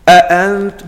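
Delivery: "أأنت" with both hamzas punched hard and loud; overdone hamza. Retold or imitated. Imitating the imam who overdid it: exaggerated hamzas in "أأنت". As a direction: hit each hamza of "أأنت" like a retching stop. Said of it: "هذا الإمام الذي كان يبالغ فيها".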